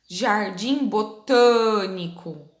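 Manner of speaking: "jardim botânico" slowly, with a long, closed TÂ; closed stressed A. Shown as a demonstration. Teacher reading group one aloud, closed stressed A. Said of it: "Lê em voz alta".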